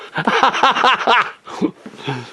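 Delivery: high staccato laugh; long, high cackling burst of laughter. Real, not acted. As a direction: laugh in a high rattling run. Speaker bursts into own laughter.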